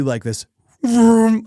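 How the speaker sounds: high, drawn-out vroom sound; a rising whoosh of a car rushing by. Retold or imitated. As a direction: mimic something whooshing past, loud and slow. Trish rushing past his ear.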